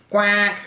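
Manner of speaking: high, loud duck quack. A narrator's reading. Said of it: "The duck answered".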